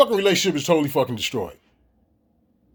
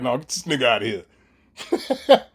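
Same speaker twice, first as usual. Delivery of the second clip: mock dismissive voice; 9 semitones lower, slower than usual. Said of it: "It's like".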